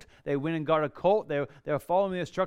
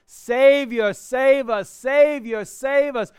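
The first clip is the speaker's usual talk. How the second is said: higher and much louder, a pleading cry; crowd crying out; 8 semitones higher, 10 dB louder, slower than usual. Retold or imitated. Crowd at the triumphal entry begging Jesus, loud pleading cry. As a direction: call it out like the crowd begging for rescue. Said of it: "people are crying out".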